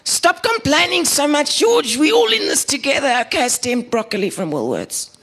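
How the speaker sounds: piercingly high mock scolding voice; Gillian. Gillian tells a neighbour to stop complaining, very high caricature voice. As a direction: squeal it out in one long, high-pitched reproach. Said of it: "read this in the voices that I believe".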